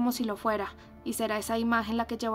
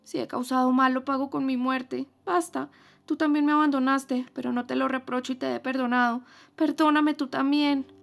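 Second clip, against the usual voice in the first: mild sobbing plea; 4 semitones higher, 7 dB louder than usual. Acted. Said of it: "respondió Catherine sollozando".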